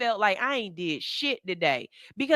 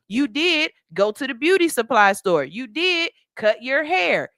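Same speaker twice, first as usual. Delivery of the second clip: usual speaking voice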